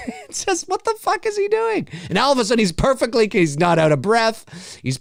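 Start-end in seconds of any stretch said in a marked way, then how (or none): 0.1-1.9 laughing disbelief. Real, not acted. Mocking the sheriff.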